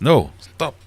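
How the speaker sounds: ordinary talk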